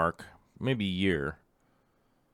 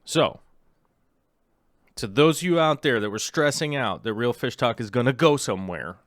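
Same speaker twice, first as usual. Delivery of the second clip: ordinary talk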